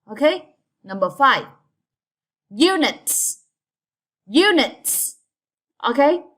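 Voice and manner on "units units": Units, high first syllable and a clear ts ending; crisp final S. Shown as a demonstration. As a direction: hit U high and end Units on a sharp s. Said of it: "It's end with S".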